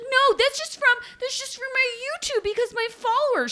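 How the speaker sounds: high mocking whiny voice; mocking Stephanie. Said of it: "And she's like".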